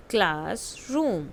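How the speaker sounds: "Classroom" drawn out slowly; slow model word. Shown as a demonstration. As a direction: say it slowly for the kids to follow. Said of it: "listen to your teacher and say the following word". (धीरे-धीरे, साफ़ "classroom")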